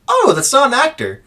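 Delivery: high, startled yelp of realization; startled Isildur. Acted. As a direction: pitch it up in sudden alarm. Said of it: "Isildur's gonna go".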